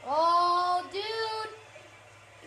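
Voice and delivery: high, drawn-out yell; excited cry. Real, not acted. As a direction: stretch it out high, hyped for action. Big moment in the game.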